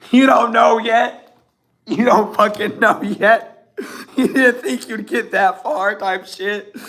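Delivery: slow, loud words through laughter; real laughter. Real, not acted. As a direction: laugh while drawing the words out, pitched high. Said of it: "I don't know why I'm laughing so much".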